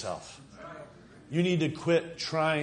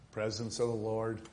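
ordinary talk, nothing marked

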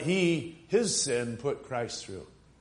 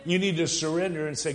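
ordinary talk, nothing marked

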